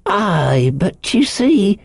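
high, slow, wheedling sailor; eccentric castaway, coaxing. A narrator's reading. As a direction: coax him in a high castaway voice. Ben Gunn's voice.

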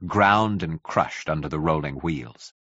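ordinary delivery, nothing marked

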